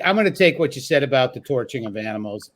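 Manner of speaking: ordinary talk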